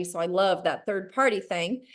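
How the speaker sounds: ordinary delivery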